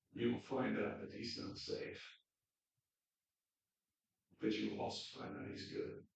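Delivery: soft, whispered sincerity; hushed whisper. Real, not acted. Preacher applying the story to listeners, softly whispered. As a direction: lean in and whisper the truth.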